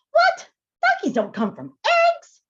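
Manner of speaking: can't believe it; shocked hare. Acted.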